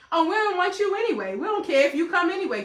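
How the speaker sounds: high, loud mocking retort; mock dismissive. Relatives saying they don't want you, mock dismissive.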